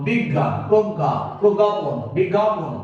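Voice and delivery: বিজ্ঞান drawn out slowly, half-sung; slow demo. Shown as a demonstration. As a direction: sound out বিজ্ঞান slowly for the class. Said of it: "যেমন আমরা এখন লিখবো".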